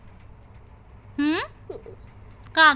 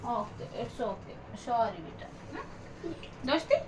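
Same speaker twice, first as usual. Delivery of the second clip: much softer, slow comforting words; soft soothing; 12 dB quieter than usual. Real, not acted. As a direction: hush her gently, slowly. Mother soothes child after apology.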